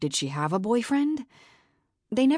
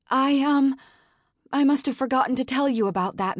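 higher, louder and fast, flustered; panicked; 8 semitones higher, 4 dB louder than usual. Acted. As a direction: blurt it out in a panic. Panicked assistant admits mistake.

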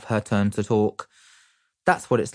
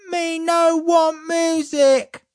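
put-on very high, slow toddler yell; toddler shout; 4 dB louder, much slower than usual. A narrator's reading. Toddler Desmond shouts at dad's music. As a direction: shout it like a cross little kid. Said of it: "shouted Desmond".

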